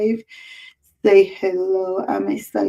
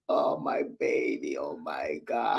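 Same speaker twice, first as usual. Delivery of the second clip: very low, soft and choked up; real tearful murmur; moved to tears; 9 dB quieter than usual. Real, not acted. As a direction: overcome with love, nearly crying. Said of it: "you're gonna make me cry".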